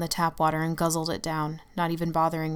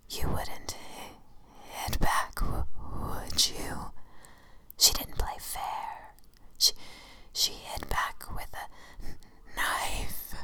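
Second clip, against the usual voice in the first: creepy whisper; 5 dB quieter, much slower than usual. Acted. The shadow taunts her.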